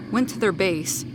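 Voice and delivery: usual speaking voice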